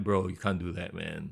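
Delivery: disappointed bro. Retold or imitated. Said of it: "I'm like".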